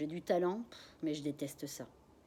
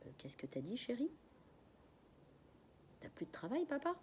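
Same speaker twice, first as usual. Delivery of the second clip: stunned soft question; 6 semitones higher, 8 dB quieter than usual. Acted. Daughter stunned, asking softly.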